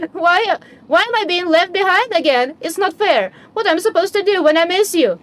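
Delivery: put-on abandoned child weeping it's not fair; tearful child. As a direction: sob out the unfairness. Child left behind again.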